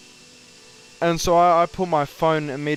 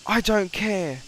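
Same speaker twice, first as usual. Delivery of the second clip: higher, slowed mock snap of a bossy supervisor; mock yelling manager; 6 semitones higher, slower than usual. Imitating the manager dismissing his apology, mock yell. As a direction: play the boss cutting him off. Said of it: "He's screaming at me".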